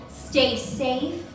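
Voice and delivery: normal talking voice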